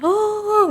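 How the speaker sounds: a high, imitated sobbing "ah"; retelling the wife crying hysterically at the mall, a high mock wail. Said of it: "Histeris nangis".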